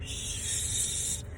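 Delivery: long urgent shush; acted shushing. Girl silencing boyfriend as mother nears, shushing. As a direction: hush him with a drawn-out hiss.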